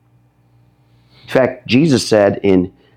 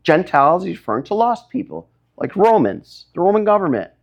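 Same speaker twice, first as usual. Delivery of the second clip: usual speaking voice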